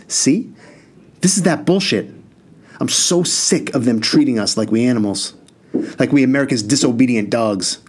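put-on furious older brother, voice climbing; losing it. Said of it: "Spoonie lost it".

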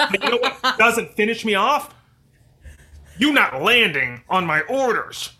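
loud, high, hammed-up commander; yelling commander. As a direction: bark like a worked-up commanding officer. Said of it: "So it's like, you know".

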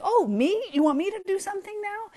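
high-pitched, mock-surprised kid; surprised eagerness. Kids hearing their names called, eagerly surprised. Voicing kids suddenly switched on.

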